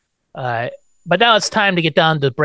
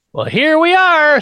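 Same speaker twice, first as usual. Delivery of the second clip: much higher, louder, slow sing-song greeting; Lily's grand entrance; 6 dB louder, slower than usual. Retold or imitated. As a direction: sweep in with a high theatrical hello. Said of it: "says Lily".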